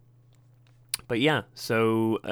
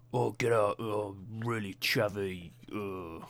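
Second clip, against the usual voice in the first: drawn-out chavvy Londoner, fading away; chavvy London accent; 4 dB quieter, slower than usual. Shown as a demonstration. Podcaster attempting his area's accent, hammed-up chavvy Londoner. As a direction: put on a slow, rough chav accent. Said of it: "I guess a chavvy Londoner works".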